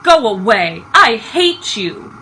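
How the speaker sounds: put-on loud child's outburst, slowing on the last words; furious shout. A narrator's reading. On pushing grandfather away, furious shout. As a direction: scream it at him in a rage. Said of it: "On shouted".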